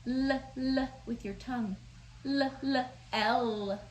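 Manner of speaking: "L" held with the tongue on the teeth; L sound. Shown as a demonstration. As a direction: model a long l sound. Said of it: "Do you guys feel that one?".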